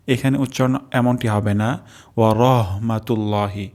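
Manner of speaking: ordinary delivery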